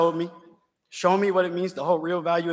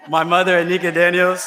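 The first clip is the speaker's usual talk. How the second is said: louder, laughing through the names; 3 semitones higher, 7 dB louder than usual. Real, not acted. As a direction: laugh as you call them out again.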